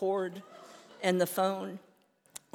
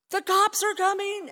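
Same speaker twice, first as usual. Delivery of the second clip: much higher, loud alarm call; panicked scream; 13 semitones higher, 9 dB louder than usual. Retold or imitated. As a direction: yell the warning to your boyfriend. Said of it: "I screamed to my boyfriend".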